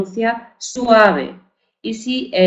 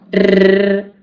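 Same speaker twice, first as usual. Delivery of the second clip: a long rolled rrr, tongue buzzing; teacher demonstrating the trilled r; 4 dB louder than usual. Shown as a demonstration. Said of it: "estoy vibrando".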